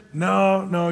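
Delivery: ordinary talk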